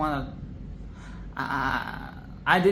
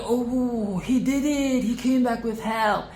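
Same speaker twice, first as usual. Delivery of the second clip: higher, lightly excited crowd voice; mock cheer; 7 semitones higher than usual. Mimicking the colony as Flick returns, mildly excited cheer. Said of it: "everybody's like".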